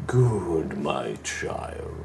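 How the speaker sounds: low, slow and soothing menace; a charming devil speaking softly; deep terrifying voice. Acted. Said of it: "deep, charming, soothing, but also terrifying voice".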